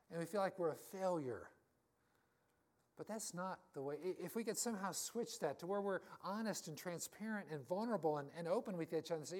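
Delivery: ordinary talk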